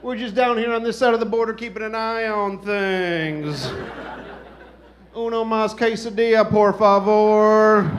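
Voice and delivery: hammed-up clueless American ordering in bad Spanish; drawn-out, loud "por favor" like an ugly tourist; mock Texan expat. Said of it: "they're like".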